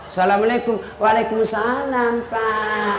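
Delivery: a hint of a breathy, sultry answer; soft sultry voice. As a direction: answer the boss soft and seductive. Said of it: "jangan kasih sahdu suaramu". (suara lembut dan syahdu yang menggoda)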